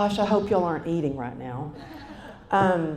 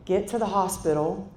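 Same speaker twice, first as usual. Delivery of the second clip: ordinary talk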